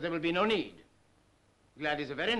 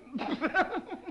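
high laugh; 12 semitones higher than usual. Acted. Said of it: "stop laughing at me".

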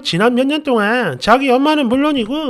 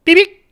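high chirpy beep sound; playful robotic beep; mock beep; 7 semitones higher, 5 dB louder than usual.

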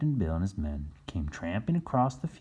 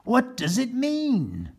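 high, slow questioning voice; puzzled captain asking; Captain Bill; 5 dB louder, slower than usual. Acted. Captain Bill asking his son in high slow voice. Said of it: "said Captain Bill".

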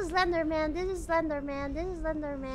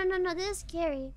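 ordinary talk

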